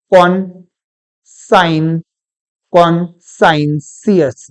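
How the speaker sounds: कॉन्साइंसियस drawn out, "kon-sine-see-us"; very slow कॉन्साइंसियस with s before the ending; wrong pronunciation. Shown as a demonstration. Teacher sounds out what is wrong, कॉन्साइंसियस slowly as kon-sine-see-us. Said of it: "नहीं होता".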